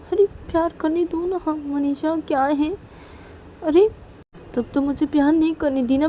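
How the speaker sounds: whiny coaxing. Acted.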